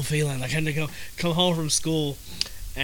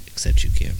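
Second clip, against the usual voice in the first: whisper; 7 dB louder than usual. Acted. Sly jab at Kim.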